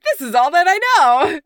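high, loud, proud outburst; excited shout. Retold or imitated. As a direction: shout it out with pride. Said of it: "Evie is like".

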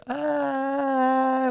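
high, hammed-up crying wail; mock cry wail. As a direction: imitate a comic sob. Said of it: "like he cries like that".

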